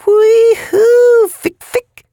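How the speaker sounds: wild high-pitched whooping; ecstatic high hoots. Acted. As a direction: hoot up high, blissed out. Drug-addled dealer hoots.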